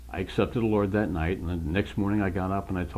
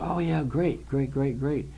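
higher-pitched eager yeses; excited; 5 semitones higher, slower than usual. Retold or imitated. New believer replying to his cousin's advice, excited. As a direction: recall your excitement, repeating it eagerly. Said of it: "like, all excited".

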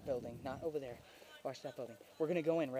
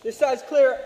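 call out; 5 semitones higher, 13 dB louder, slower than usual. Real, not acted. Calling out to team.

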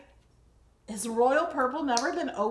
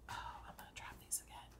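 whisper; 21 dB quieter, much faster than usual. Real, not acted. Muttering while juggling items.

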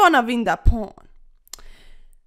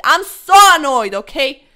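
annoyed outburst; 10 dB louder than usual. Real, not acted.

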